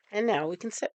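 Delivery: ordinary delivery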